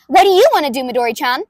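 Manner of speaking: bulldoze him with gleeful energy; brash inventor girl, throaty and loud; loud brutal May voice. Acted. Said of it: "I do it in the back of the throat, and I do it loud".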